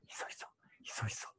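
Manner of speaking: demonstrating a very soft whisper; hushed private talk. Shown as a demonstration. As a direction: whisper it like a secret being passed on. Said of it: "すごいパーソナルトーク". (ひそひそ声の実演)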